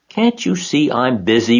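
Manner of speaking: put-on high, annoyed boy snapping back; disgusted. A narrator's reading. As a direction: huff at the nagging aunt. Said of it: "replied Avery in disgust".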